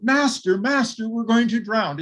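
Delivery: frightened disciples yelling to wake their master; panicked shout. Retold or imitated. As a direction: call out to him as the boat fills with water. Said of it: "they actually shout".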